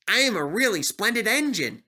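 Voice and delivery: lightly voiced proud engine; proud James. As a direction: James preening a little. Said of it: "he said".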